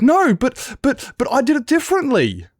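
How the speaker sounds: whiny little brother. Retold or imitated. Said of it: "And it's like".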